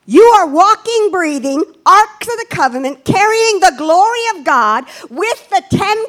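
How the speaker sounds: stirring sermon delivery, hitting the repeated words; preaching with fervor. Acted. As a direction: drive each phrase home to the church. Preaches to the congregation.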